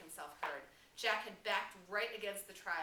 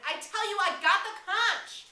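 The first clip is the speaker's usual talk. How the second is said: insistent shout; 13 semitones higher, 16 dB louder than usual. Acted. Piggy insisting to the tribe he has the conch. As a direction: insist over the booing. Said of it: "he shouted".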